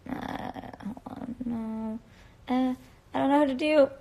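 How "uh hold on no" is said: whispering to self. Real, not acted. Fumbling with the live invite.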